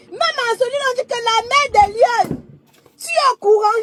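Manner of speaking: excited retelling. Retold or imitated. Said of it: "Je dis que". (récit animé, voix plus aiguë)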